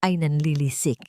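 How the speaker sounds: ordinary delivery, nothing marked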